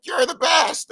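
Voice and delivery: high-pitched shout of praise, re-enacted; fan's shout. Fan in the front row to the performer, shouted out. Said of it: "just shouted out".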